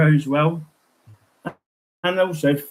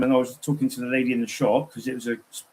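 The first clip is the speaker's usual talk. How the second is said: ordinary talk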